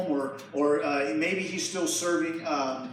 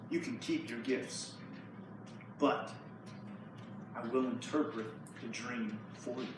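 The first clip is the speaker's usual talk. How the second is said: low, soft and steady; Daniel quietly waving away the gifts; calm quiet refusal; 6 semitones lower, 12 dB quieter than usual. Acted. Daniel calmly refuses the king's gifts, speaking softly.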